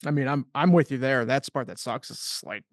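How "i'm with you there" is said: ordinary talk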